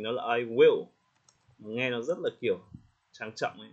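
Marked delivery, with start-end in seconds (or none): 0.4-0.8 WILL punched loud; full "I will" with heavy weight on WILL; stress on WILL. Shown as a demonstration. Teacher showing what sounds too formal, WILL stressed. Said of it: "thay vì mình nhấn vào will".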